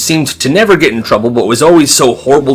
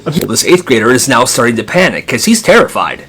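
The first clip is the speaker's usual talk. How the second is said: usual speaking voice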